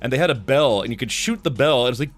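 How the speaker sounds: normal talking voice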